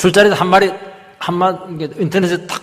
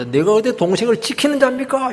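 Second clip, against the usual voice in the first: defiant retort; 8 semitones higher than usual. Acted. Cain talking back to God about his brother, defiant. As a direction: act out an angry talking-back. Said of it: "막 화를 팍 냅니다".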